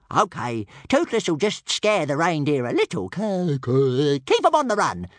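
loud, high stuttering creature squawk; little dragon voice. A narrator's reading. Toothless agreeing to scare reindeer a little, eager squawk.

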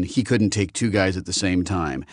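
ordinary delivery

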